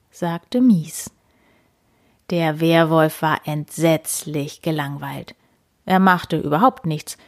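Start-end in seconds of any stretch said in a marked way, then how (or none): none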